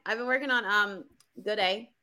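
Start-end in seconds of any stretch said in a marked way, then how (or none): 1.4-1.9 attempted Australian accent. Shown as a demonstration. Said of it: "I'm working on mine".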